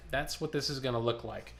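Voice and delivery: ordinary talk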